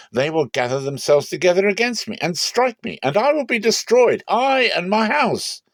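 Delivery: high, rising cry of dread, slowing at the end; a frightened father wailing; loud and high, breaking with fear; fearful lament. Acted. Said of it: "Jacob said to Simeon and Levi".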